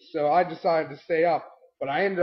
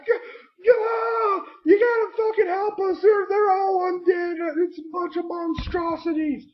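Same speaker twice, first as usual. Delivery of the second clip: much higher, shouted panic; panicked shout; 13 semitones higher than usual. Retold or imitated. Shouting to the boat captain for rescue, panicked. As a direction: yell for help while fleeing. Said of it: "running to it, like".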